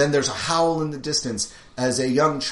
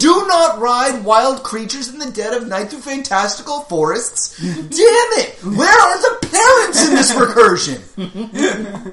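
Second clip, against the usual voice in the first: mock PSA lecture; 13 semitones higher, 9 dB louder than usual. Mock PSA continues, laughing.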